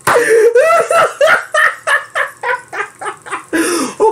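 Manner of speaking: long high-pitched laugh; real helpless cackling; laughing fit. Real, not acted. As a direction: let the laugh run out. Breaks up at his own joke.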